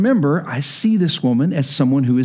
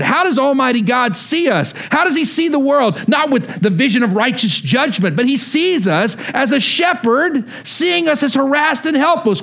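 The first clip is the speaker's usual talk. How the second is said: full-force preaching; 7 semitones higher than usual. Acted. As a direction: lift the room with conviction.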